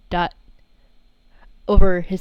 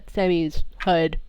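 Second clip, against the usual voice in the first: ordinary talk, nothing marked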